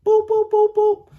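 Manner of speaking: loud, very high, rapid-fire boops; imitated door knocking. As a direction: mimic urgent knocks with the voice. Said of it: "Knock right on her door".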